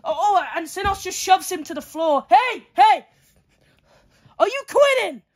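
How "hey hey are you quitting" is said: referee call. Pretend.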